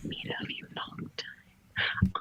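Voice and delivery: slow drawn whisper. Pretend. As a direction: stretch it in a whisper. Slow ASMR whisper joke.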